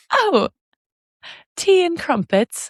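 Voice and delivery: high, slow delighted British voice; British accent. Pretend. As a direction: relish it like a posh Brit. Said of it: "say everything British we can think".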